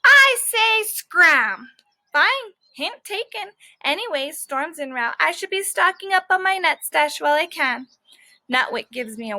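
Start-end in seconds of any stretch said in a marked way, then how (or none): 0.0-1.7 higher, louder and very slow; drawn-out grumpy dismissal; dog says scram. Acted. Dog ordering squirrel away. 2.1-7.9 a lightly voiced cocky squirrel; breezy squirrel.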